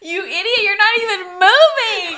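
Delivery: high, loud, laughing yell; mock scolding shout. Sister to swimmer stuck at the start, mock shout. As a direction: holler the insult as the retold sister.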